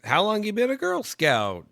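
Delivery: put-on high, loud question for a little kid; over-keen grown-up quizzing a small child; sweet talk to a child. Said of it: "I'm kinda talking to her".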